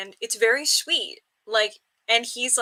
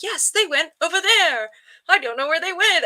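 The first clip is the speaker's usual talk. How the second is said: higher, louder, with a light Hong Kong British lilt; playful Hong Kong British accent; 7 semitones higher, 5 dB louder than usual. Retold or imitated. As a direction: slip into a partly British, Hong Kong accent to fool the police. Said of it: "like, Hong Kong British accent together".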